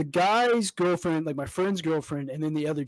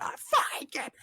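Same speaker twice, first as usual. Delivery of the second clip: mock scream; 10 semitones higher than usual. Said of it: "bloody murder".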